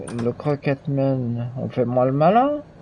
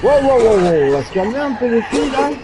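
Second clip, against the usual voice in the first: alarmed protest; 9 semitones higher, 5 dB louder than usual. Real, not acted. Player attacked by game enemies.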